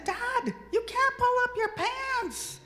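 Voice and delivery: very high, loud mock holler; retold exclamation. Daughter calling out dad after his plan, exclaiming loudly. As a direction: cry it out in high-pitched, laughing-stock disbelief. Retort to dad in funny story.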